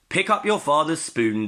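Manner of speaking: Missus Porker. A narrator's reading. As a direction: tell her daughter off.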